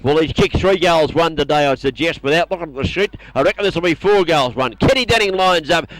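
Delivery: ordinary talk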